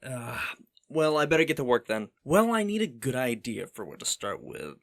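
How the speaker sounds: ordinary talk, nothing marked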